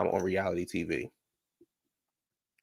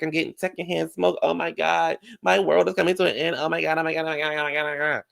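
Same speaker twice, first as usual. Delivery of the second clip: mocking Kyle; 4 semitones higher than usual. Mocking Kyle's fuss over her children, high and whiny. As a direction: ham up a fussy mother's dramatic fuss. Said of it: "Kyle was like".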